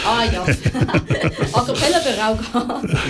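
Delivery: lacht und schiebt einen Scherz nach; lachend. Real, not acted. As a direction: locker durchs Lachen reden. Scherz über A-cappella-Version. (lachend scherzen)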